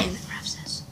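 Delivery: hushed prompt; whispering. Acted. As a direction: whisper it low. Whispering lines to partner.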